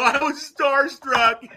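high, loud, slow sheepish admission; sheepish confession. Retold or imitated. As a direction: stretch it out, embarrassed by yourself. Admitting awkwardness to owner.

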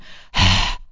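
imitated annoyed sigh; huffy sigh. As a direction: mimic an exasperated huff of breath. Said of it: "he does a huffy sigh".

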